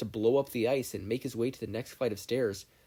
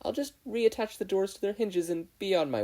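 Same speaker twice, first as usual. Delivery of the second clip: flustered hurry; 10 semitones higher, 3 dB louder than usual. A narrator's reading. Flustered Izuku leaving.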